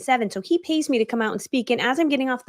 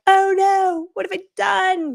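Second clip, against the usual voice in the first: higher, louder, slow mock despair; dramatic wail; 10 semitones higher, 5 dB louder, slower than usual. Mom lying at husband's office door, wailing in mock despair. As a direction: ham it up like the sky fell.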